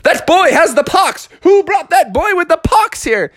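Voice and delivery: high, loud crowd outcry; alarmed outcry. Acted. Everybody in the pool pointing out the sick boy, alarmed yell. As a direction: call out in shrieky mock horror.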